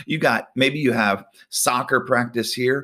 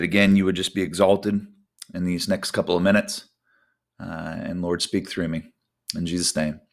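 prayer; 4 semitones lower than usual. Acted. Opening prayer.

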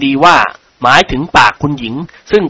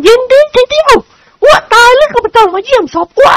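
angry again; 3 dB louder than usual. A narrator's reading. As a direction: hurl it back in anger. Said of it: "โกรธอีก". (คุณหญิงโกรธเสียงสูง)

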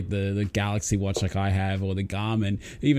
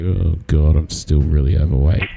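low, loud groaning lament; mock despair; 6 semitones lower, 8 dB louder than usual. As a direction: lament it like a sad sigh. Self-quote reacting to BMI calculator.

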